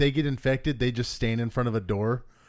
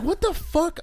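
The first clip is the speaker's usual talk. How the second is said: shocked. Retold or imitated. Shocked reaction to card news.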